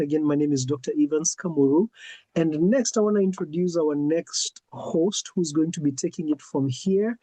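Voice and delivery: usual speaking voice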